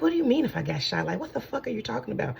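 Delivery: high, rapid incredulous questioning; retold disbelief. Retold disbelief at being shot.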